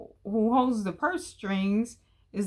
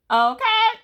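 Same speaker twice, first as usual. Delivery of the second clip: high, loud pointed okay; emphatic okay; 10 dB louder than usual. Real, not acted. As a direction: hit it like a challenge. Emphatic challenge to viewer.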